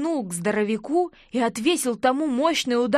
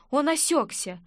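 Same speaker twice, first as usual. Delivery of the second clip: ordinary delivery, nothing marked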